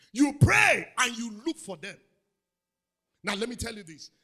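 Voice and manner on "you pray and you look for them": louder preaching rebuke to the cousin. Retold or imitated. Preacher scolding his cousin about Christians only praying, loud. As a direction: push her to act, loudly.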